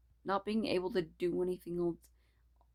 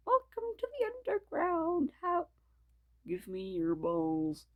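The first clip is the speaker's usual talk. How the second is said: joke voice; 10 semitones higher than usual. Acted. Reader mock-welcomes listeners in a silly voice. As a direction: ham it up as a creepy host.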